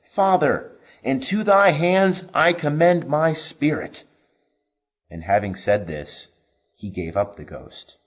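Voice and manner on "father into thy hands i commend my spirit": loud cry. A narrator's reading. Jesus crying out to the Father with his last breath. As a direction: cry it out to heaven. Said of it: "crying with a loud voice".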